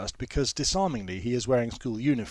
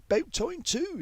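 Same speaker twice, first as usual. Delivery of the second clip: high, put-out little grumble; mock impatience, pitched high; irrational grumble; slower than usual. A narrator's reading. Older woman grumbling about the late minibus, high and put out. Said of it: "she adds, somewhat irrationally".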